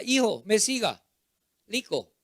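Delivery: father teaching "rico" but saying "liko"; father's mispronunciation. Acted. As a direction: model "rico" with an L for the R. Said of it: "papá decía".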